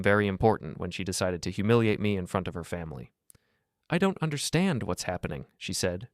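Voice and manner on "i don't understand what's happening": higher, mildly distressed; understated break in the voice, pitched up. A narrator's reading. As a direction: plead in a higher, upset voice. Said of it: "her voice breaking".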